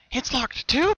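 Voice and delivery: high, startled disbelief; stunned to find the door locked; a pony voice gasping in surprise; bewildered. Acted. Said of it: "she asked, bewildered".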